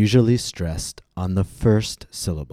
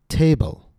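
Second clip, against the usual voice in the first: TA in "Table" raised high with a long vowel; stressed first syllable; 9 semitones higher than usual. Shown as a demonstration. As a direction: lengthen and lift the first syllable of "Table", then drop. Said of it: "I raise my pitch".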